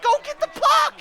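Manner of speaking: very high, loud yell; high-pitched frantic shout; urgent yell. Real, not acted. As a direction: yell urgently at your own players. Urging players in live game.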